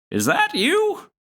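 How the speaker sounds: high, wavering granny calling out; old grandmother voice. Acted. Grandmother calling to Zack from the next room, high old-lady voice. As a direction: call out like a confused old gran.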